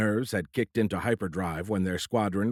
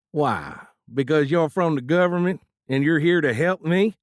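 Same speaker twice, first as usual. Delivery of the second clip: put-on hillbilly accent; 7 semitones higher, 6 dB louder than usual. A narrator's reading. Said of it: "thickened his soft hillbilly accent".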